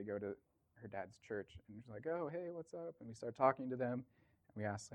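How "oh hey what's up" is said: high, soft casual hello; retold friendly greeting. Translator greeting people she knows, friendly greeting. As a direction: toss off a higher, softer greeting.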